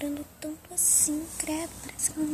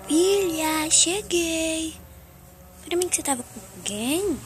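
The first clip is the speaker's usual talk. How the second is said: loud pretend mum arriving home, calling to her daughter; mother calling out; 9 dB louder than usual. Mother doll home and checking on her daughter, loud calling. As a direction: call out like a suspicious mum coming in.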